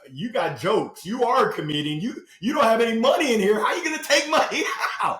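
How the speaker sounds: hammed-up teller laughing him off; mock bank reply. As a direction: play the bank scoffing at an empty account. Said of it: "Bank of America said".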